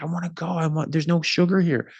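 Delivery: high-pitched imitation of a whining kid; child's tantrum. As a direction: mimic a hungry child throwing a fit. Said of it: "throwing an absolute fit".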